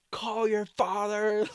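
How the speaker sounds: imitating mom drawing out a desperate command; frantic mom. Imitating mom telling her son to call dad, panicked.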